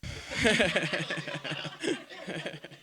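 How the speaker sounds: real chuckling laugh. Real, not acted. Teacher amused with the congregation, laughing. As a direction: laugh along in a long run. Joke while congregation stands up.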